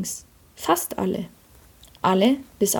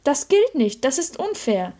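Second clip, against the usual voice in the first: higher-pitched, mildly aggrieved complaint; indignant protest; 6 semitones higher than usual. A narrator's reading. The sinless soul complains about the forgiven sinners, mildly indignant. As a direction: protest the unfairness in a raised voice.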